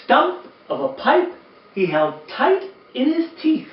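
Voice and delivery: lively, sing-song storybook reading; reading to children. A narrator's reading. Uncle Mitch reading a Christmas poem to kids, animated storytelling. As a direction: read it like bedtime story time for kids.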